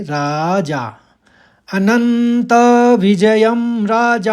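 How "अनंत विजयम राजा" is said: अनंत with a light short न, slow and higher; Sanskrit pronunciation. Shown as a demonstration. Teacher demonstrates the first quarter slowly to students. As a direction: sound out अनंत विजयम राजा slowly, राजा in full. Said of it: "राजा आप पूरा पूरा पढ़ेंगे".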